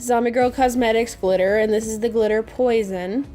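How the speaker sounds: ordinary delivery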